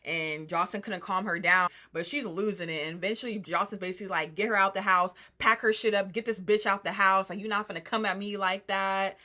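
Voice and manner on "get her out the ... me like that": louder, forceful imitated outburst; retold angry orders. Boss ordering a housemate out, loudly fed up. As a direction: throw the orders out hard and loud.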